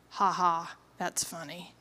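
low, deadpan "ha ha"; unamused, dropping very soft at the end; dry mock laugh. Woman reacting to the book's question, dryly unamused.

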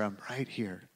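God whispering comfort in someone's pain. Retold or imitated. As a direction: barely breathe the promise. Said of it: "And hear him whisper".

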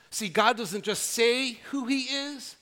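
ordinary talk

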